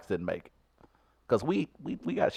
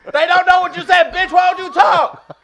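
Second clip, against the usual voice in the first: shout; 5 dB louder than usual. Pretend. Yelling at silent moviegoer.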